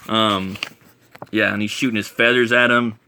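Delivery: ordinary talk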